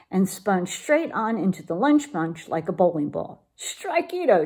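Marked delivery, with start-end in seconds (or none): 3.6-4.4 much higher triumphant cheer; cheering shout. Acted. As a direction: cheer the strike like a crowd. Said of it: "shouted the Puchitos".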